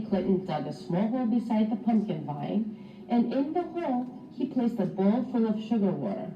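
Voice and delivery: ordinary delivery, nothing marked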